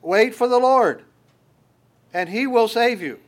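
proclaimed. Acted. Preacher answering his own question to the congregation, proclaiming high and loud. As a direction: ring out the promise.

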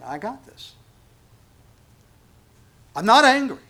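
yelled I'm not angry, high and loud; comic angry shout of denial. Retold or imitated. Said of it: "most of us are saying".

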